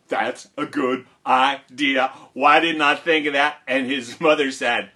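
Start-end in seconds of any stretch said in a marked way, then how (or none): 0.1-3.6 loud, slow dawning realization; dim-witted amazement. Acted. Jack answering his mother's advice, loud slow amazement. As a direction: marvel loudly at the bright idea, drawn out.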